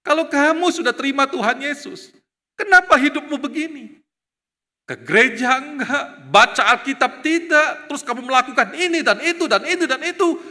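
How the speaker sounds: loud, high, pointed challenge; confronting rebuke. Retold or imitated. Student confronting the careless believer, loud and high. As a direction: hammer him with each sin. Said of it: "Dia mengatakan begini".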